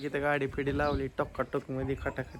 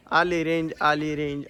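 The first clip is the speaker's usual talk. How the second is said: loud, raised call-out; excited announcement; 2 semitones higher, 9 dB louder than usual. Real, not acted. Vlogger announcing the phone signal is back, excited. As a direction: announce it loudly, pleased it's here.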